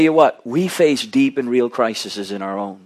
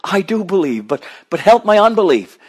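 raised, pleading cry to the Lord; 6 semitones higher than usual. Retold or imitated. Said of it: "this man cried out".

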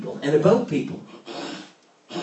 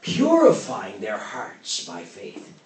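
fervent preaching; 6 semitones higher, 6 dB louder than usual. Acted. Preaching at full force.

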